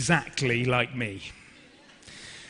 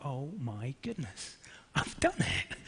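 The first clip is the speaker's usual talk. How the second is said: very soft, retold disbelief fading to a whisper; hushed amazement; 8 dB quieter, slower than usual. Actor privately realises the audition went well, hushed amazement. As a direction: breathe it as a stunned private thought.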